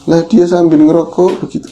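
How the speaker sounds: ordinary talk, nothing marked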